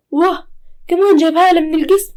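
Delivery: a mother aghast at her son; loud scandalized exclamation; shocked mother. Acted.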